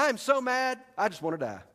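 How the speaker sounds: high, loud pouting outburst; furious whine. Acted. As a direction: throw a tantrum at God. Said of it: "I can hear him saying this".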